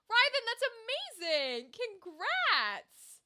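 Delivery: high, emphatic delight; delighted, proud exclamation; excited congratulations. Real, not acted. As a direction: cheer your friend on. Congratulating friend on an honor.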